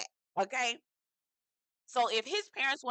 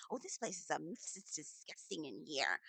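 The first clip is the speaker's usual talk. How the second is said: softer, lower mock of her fussing; mock Hannah voice; 5 semitones lower, 12 dB quieter than usual. Mocking her fussing, much softer. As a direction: put on a fussy voice.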